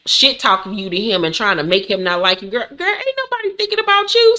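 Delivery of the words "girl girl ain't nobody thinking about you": high, sassy brush-off; dismissive sass. Retold or imitated. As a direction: wave her off, high and sassy.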